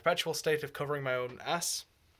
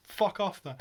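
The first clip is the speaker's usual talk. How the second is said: ordinary talk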